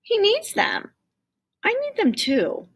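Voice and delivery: put-on high, eager little boy; child's voice. A narrator's reading. Tim pleading with Jamal for the cans, high child voice. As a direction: plead like a young kid.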